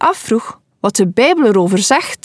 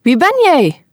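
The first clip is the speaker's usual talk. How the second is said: curious call; 6 semitones higher than usual. A narrator's reading. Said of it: "Vol nieuwsgierigheid roept hij".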